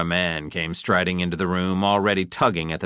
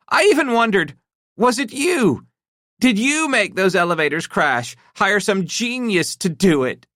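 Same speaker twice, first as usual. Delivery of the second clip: high, tense, hurt wife voice; a betrayed wife working herself up; upset wife; 5 dB louder than usual. A narrator's reading. Wife confronting husband about his secret, tense and hurt.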